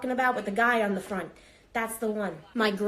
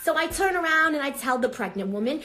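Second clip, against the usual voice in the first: ordinary talk, nothing marked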